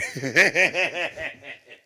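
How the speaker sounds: high loud laugh burst. Real, not acted. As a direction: break into laughter. Laughing at own story.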